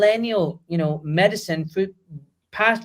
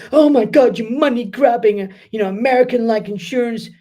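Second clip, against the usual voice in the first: high, loud mock outrage; hammed-up outraged critic; mock accuser; 5 semitones higher, 5 dB louder than usual. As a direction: put on an indignant critic. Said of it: "you might turn around and go".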